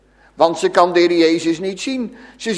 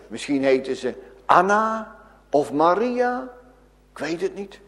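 ordinary delivery

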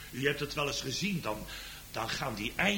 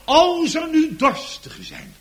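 much higher and louder call; loud proclamation; 14 semitones higher, 13 dB louder than usual. Acted. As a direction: ring it out over the pews. Preacher proclaims the Lord's call.

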